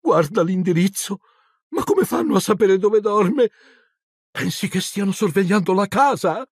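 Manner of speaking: scared wife whispering worries; trembling voice. A narrator's reading. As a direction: fret in a frightened rush. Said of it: "con voce tremante".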